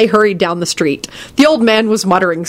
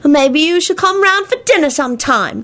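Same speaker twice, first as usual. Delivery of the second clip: put-on high child's quick suggestion; high-voiced boy interjecting; hasty interjection; 10 semitones higher than usual. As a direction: jump in eagerly as a boy. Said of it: "Teddy hastily interjected".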